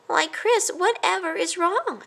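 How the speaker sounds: high, caring mouse voice; concerned mouse. A narrator's reading. A cousin gently asks what is wrong, high. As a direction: ask gently worried.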